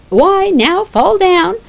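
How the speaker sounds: loud, taunting mockery; scornful Phoebe. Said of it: "Act like you're gonna faint".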